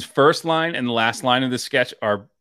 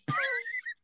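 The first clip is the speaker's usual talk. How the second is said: high squealing laugh; 14 dB quieter than usual. Real, not acted. Friends recapping a sketch, high squealing laugh. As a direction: laugh that jumps way up.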